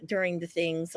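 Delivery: normal talking voice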